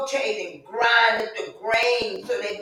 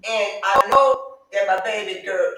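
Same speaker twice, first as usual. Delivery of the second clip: usual speaking voice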